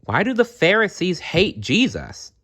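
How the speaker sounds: put-on high, earnest child's question; puzzled child. Boy Jarom asking friends, high puzzled voice. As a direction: ask it like a bewildered boy.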